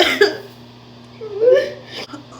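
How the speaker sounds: high sobbing laugh; tearful disbelieving laugh; crying laugh. Real, not acted. Shock after positive test.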